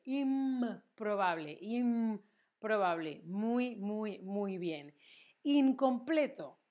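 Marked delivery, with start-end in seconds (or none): 0.0-3.2 "improbable" slowly, humming on the m. Shown as a demonstration. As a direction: hold the m of "improbable" before the p.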